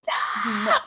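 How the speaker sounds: high-pitched imitated scream; mock toddler scream. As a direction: squeal "no" like a frustrated toddler. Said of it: "And she would scream".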